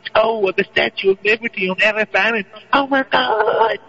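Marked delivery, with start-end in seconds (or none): 2.7-3.8 high, slow gasping "Oh, my God"; hammed-up shocked gasp; mock shock. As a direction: drag it out in exaggerated disbelief. Mock shocked reaction to news.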